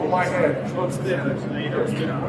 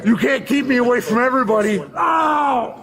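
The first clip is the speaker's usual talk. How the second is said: angry yelling; 6 semitones higher, 6 dB louder than usual. Real, not acted. Restrained man yelling at officers.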